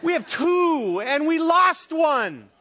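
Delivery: very high, loud comic outcry; hammed-up parental disbelief at full voice; mock exasperated shout. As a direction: wail it loudly, stretching the end. Retelling parents' outrage at losing child.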